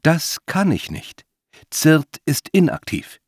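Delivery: high, slow, deliberate machine reply; genderless voice. A narrator's reading. Unseen voice refusing the old man, genderless. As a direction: state it as a disembodied assistant. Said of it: "geschlechtslose Stimme".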